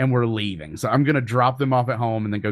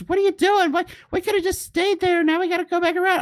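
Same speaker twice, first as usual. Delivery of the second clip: high, exasperated imitation of his wife, pitched way up; imitating furious wife. Husband imitates his wife scolding him for leaving the drive-through, high and exasperated. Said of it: "Ashley's, like, furious".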